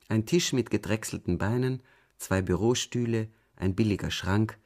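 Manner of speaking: ordinary delivery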